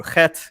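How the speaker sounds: "chet" opened with a throaty Hebrew friction sound; guttural Hebrew chet. Shown as a demonstration. Naming the Hebrew letter in his name, "chet" with guttural first sound. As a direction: start "chet" with a guttural rasp, not an English ch. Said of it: "this guttural noise I'm making right now".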